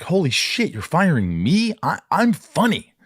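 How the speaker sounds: high, incredulous outburst of wounded pride; retold indignant disbelief, pitch shooting up; shocked ego. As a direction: sound stung and disbelieving at being fired. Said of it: "my ego was kinda, you know, I was like".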